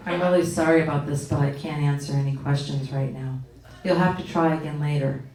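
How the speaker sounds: low monotone, insincere apology; Siri. Retold or imitated. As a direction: recite a canned apology without caring. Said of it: "seven variations of this".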